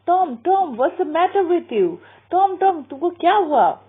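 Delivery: high, anxious calling of the name; a frightened aunt at the bedside; aunt finds Tom groaning in bed, high and worried; alarmed aunt. Acted. Aunt gasping at Tom's bedside.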